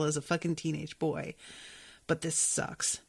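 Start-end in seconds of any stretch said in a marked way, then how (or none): 2.1-3.0 softer, half-whispered grumble; soft dejected aside. Real, not acted. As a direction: let it slip out quietly, let down. Dismayed reaction to the chapter.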